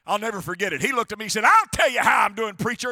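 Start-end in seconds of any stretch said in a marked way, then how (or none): 1.4-2.9 loud, higher indignant retort; imitated irate patient. Custodian answering his pastor after painful therapy, mock irate. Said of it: "that's exactly how he said it".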